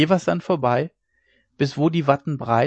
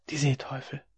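hushed; 7 dB quieter than usual. A narrator's reading. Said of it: "raunte es zitternd zwischen seine Finger".

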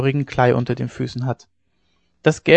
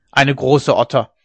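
slow, huffing scorn; old man snorts at his daughter; snorting; 5 dB louder than usual. A narrator's reading. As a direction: puff the words out, annoyed. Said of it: "rief der Alte schnaufend".

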